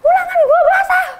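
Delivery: high shouting through laughter; laughing shout. Retold or imitated. Senior demanding water to soak the boy back, laughing shout. Confronting teacher's son.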